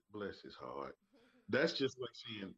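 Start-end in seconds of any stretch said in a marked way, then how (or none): 0.1-1.0 low, soft bless his heart; sweetly sarcastic church lady; Southern lady. Retold or imitated. A Southern woman pitying someone. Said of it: "If you hear a woman say".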